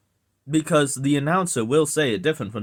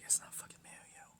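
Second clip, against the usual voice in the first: hushed, voiceless whisper; whispered grumble; 25 dB quieter, faster than usual. Retold or imitated. Irked listener correcting a video, whispered. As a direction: whisper it as if to yourself. Muttering to self at hearing it.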